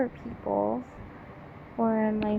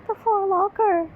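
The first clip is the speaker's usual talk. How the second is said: high, loud mock sympathy; mock pity; 8 semitones higher, 5 dB louder than usual. Real, not acted. Reacting to the story, mock pity for the locker. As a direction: wail for the poor locker.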